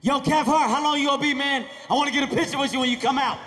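loud, eager fan calling over the stall door. Retold or imitated. Fan yelling into the bathroom stall, loud and eager. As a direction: call out to the stall, pushy and loud.

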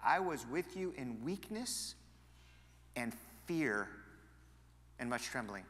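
I was with you in weakness read slowly and softly; slow, hushed repeat. Acted. Pastor rereading Paul's words slowly to the congregation. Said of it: "And he said".

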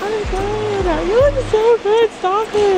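squealing hello, high and drawn out; excited high greeting. Real, not acted. Greeting a friend she missed, excited squeal. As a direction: gush over a friend you missed.

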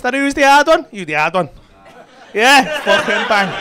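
usual speaking voice

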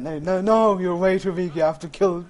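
high, loud lecturing friend voice; loud retold friend. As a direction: scold like a know-it-all friend. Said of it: "and he said".

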